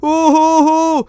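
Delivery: slow, drawn-out fake boohoo sob; mock crying. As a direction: sob it out like a lost kid putting it on. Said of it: "Loh, kok kamu nangis". (tangisan pura-pura yang pelan dan panjang)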